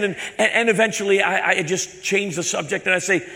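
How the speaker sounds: usual speaking voice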